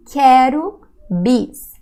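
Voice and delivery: Quero bis slow and loud; emphatic Quero bis. Shown as a demonstration. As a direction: stress Quero, slow and clear. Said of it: "here's a very cool expression".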